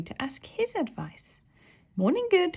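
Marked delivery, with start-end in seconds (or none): 2.0-2.5 high, bright cheerful greeting; cheery morning hello said back to front. A narrator's reading. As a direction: greet her brightly and loudly. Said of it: "he said cheerfully".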